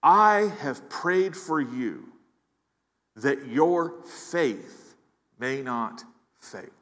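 I have prayed for you that your faith may not fail, drawn out slow with long pauses; slow, weighty reading. Shown as a demonstration. Preacher rereading Jesus' words to the congregation, slowly. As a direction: linger on every phrase, slowly. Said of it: "look at what Jesus says even more closely".

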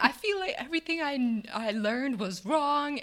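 high, whiny overwhelmed complaint; mimicking whiny trainee. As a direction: play her up as upset and whining.

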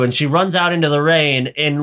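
ordinary delivery

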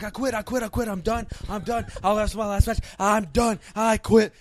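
loud, high mock meltdown, building; losing his mind. Wrestler retelling colleague's tantrum in the closet, mock meltdown. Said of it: "starts losing his mind".

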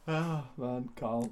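ordinary talk, nothing marked

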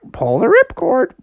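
high bird squawk; parrot-like goose. Acted. Goose telling Froggy about the parachute, squawking. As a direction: squawk out the instruction. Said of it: "Why do you talk like a parrot?".

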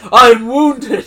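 high, loud cry of hurt; hammed-up offended protest; mock wounded. Podcaster imitates the insulted employee, mock wounded wail. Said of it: "he's just, like, wounded".

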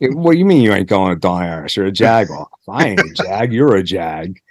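fast, hammed-up Pittsburgh bicker; mock Pittsburgh accent, rattled off fast. Shown as a demonstration. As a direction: play two Pittsburgh locals squabbling. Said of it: "Talk like this a little bit".

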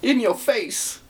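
high teasing shout; mocking taunt. Repeats taunt.

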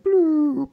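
high, loud bloop sound effect; cartoon bloop. Voicing the luck meter dropping, high cartoon bloop.

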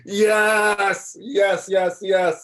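high, loud cheering yes; excited shouting. Real, not acted. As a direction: whoop it out in triumph. Cheering the Dark Knight pick.

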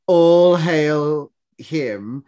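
drawn-out regal hail; mock-solemn decree; slow solemn proclamation. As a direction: proclaim it slowly like a courtier. Acting out the girl's line.

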